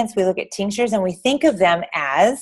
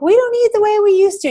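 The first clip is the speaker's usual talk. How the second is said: put-on high, louder whining kid; whiny child voice; 13 semitones higher, 5 dB louder than usual. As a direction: mimic a whining little boy. Mother retells son crying in store.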